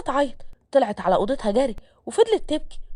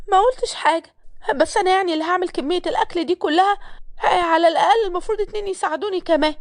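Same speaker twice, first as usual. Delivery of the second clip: higher-pitched woman's voice, backing down yet objecting; protesting maid; 6 semitones higher than usual. Acted. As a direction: play the maid timidly asking for help, pitched up. (خادمة بتعترض بصوت أعلى نبرة)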